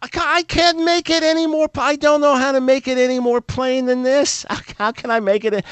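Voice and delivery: preacher's inner exasperation at being told to simplify; exasperated inner thought. Retold or imitated. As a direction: sputter in disbelief. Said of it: "And I thought".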